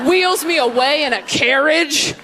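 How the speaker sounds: ordinary talk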